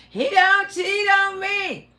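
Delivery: high, loud, sing-song mockery; mocking singsong. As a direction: mimic her boast in a teasing tune. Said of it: "mocking the bragging woman".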